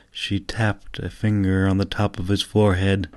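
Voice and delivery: usual speaking voice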